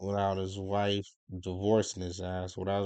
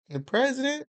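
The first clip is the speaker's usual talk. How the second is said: "The president?" thrown up much higher in mock disbelief; incredulous echo. Real, not acted. Man scoffing at Michelle Obama's comments echoes it in disbelief. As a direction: repeat "The president?" as if it's absurd, pitch soaring.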